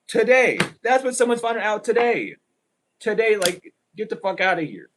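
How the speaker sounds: high, loud cry of today; emphatic shout. Real, not acted. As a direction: call it out loud like a warning. Tarot reader exclaiming urgently.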